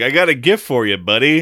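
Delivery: loud, high jokey American bully; a teasing American jock handing over a prank gift; American accent. Retold or imitated. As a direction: ham up a brash American buddy voice. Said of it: "you do the impression of Tubby just there and you put on an American accent".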